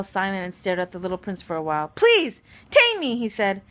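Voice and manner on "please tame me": high, loud, drawn-out plea; imploring fox, slow and insistent; pleading. Acted. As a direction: beg earnestly, slowly, with the pitch leaping up. Fox begs prince to tame him.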